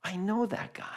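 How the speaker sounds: unvoiced whisper to herself; whispered aside. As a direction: whisper it like a private realization. Retelling own thought, whispered.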